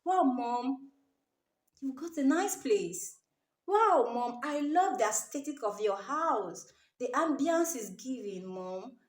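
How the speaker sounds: soft, gentle, refined praise; elegant soft compliment. Shown as a demonstration. Showing brides how to compliment the mother elegantly. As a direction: compliment her sweetly and quietly. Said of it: "compliment it in an elegant way".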